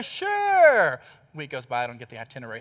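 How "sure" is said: wife's voice. Retold or imitated. Wife's breezy reply quoted.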